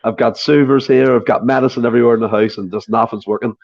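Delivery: ordinary delivery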